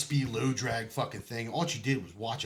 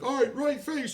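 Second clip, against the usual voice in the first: barking sergeant order; 14 semitones higher than usual. Retold or imitated.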